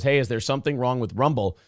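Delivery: normal talking voice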